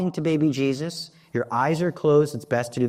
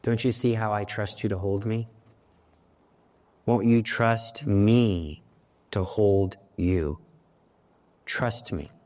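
tender voice of God; 4 semitones lower than usual. Acted. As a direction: gently coax her to trust. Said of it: "He says".

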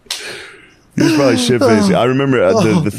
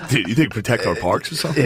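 normal talking voice

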